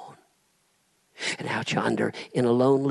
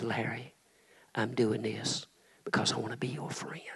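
soft, whispered words of Jesus; 7 dB quieter than usual. Acted. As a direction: breathe it tenderly to Larry. Jesus speaking in the vision.

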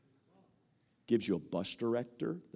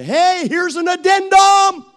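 much higher, slower, full shout; triumphant shout; 19 dB louder, slower than usual. Retold or imitated. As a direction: announce it like a big surprise. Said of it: "And I'd say".

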